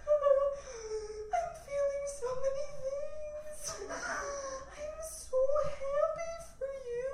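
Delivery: high, drawn-out tearful wail; hammed-up happy sobbing, words stretched out; mock weeping. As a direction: imitate someone blubbering with joy. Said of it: "she started to weep".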